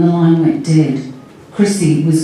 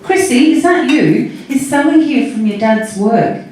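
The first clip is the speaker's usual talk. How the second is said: higher-pitched, addressed to a little one; talking to a small child; 7 semitones higher than usual. A narrator's reading. Sally to little Chrissy on the phone, child-directed. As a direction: lift the pitch as if for a young child.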